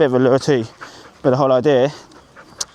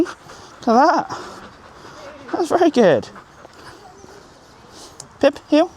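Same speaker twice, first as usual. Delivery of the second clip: praising the dog; 12 semitones higher than usual. Real, not acted. Praising then cueing dog.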